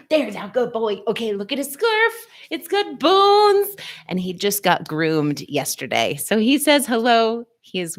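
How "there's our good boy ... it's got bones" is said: doting dog talk. Real, not acted. Cooing at her dog Marcus.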